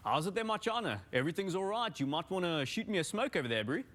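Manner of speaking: put-on Blood Diamond style South African accent, lightly done. Guest shows how South Africans talk, in a light South African accent. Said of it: "like Leonardo DiCaprio in Blood Diamond".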